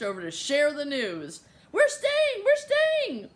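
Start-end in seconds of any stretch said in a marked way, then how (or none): 1.7-3.3 very high, loud squeal of joy; jubilant cry. A narrator's reading. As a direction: shout the news in triumph. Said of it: "cried Hyacinth".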